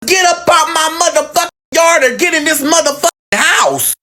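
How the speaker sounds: high, fierce grandma command; grandma yelling. Retold or imitated. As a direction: bark it like a bossy grandmother. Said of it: "And she like".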